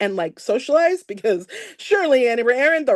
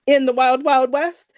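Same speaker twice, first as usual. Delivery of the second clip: ordinary talk, nothing marked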